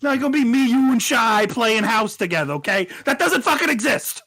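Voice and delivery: loud, high Boston tough guy; Ben Affleck in The Town. Retold or imitated. Cohost quoting Ben Affleck's Boston line, loud tough guy. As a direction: threaten like a Boston street thug. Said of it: "Ben Affleck from The Town".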